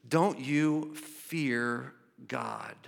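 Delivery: very slow, word-by-word rebuke; grave admonishment. Acted. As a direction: draw each word out, solemnly. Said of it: "he admonished the fellow criminal".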